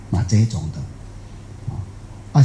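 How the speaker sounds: usual speaking voice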